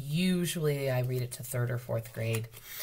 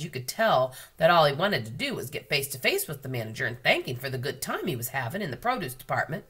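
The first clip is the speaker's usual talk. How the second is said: ordinary delivery, nothing marked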